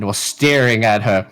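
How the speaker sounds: ordinary talk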